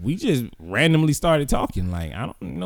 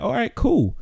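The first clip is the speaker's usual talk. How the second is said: unbothered; 12 semitones higher than usual. Retold or imitated. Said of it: "I was like".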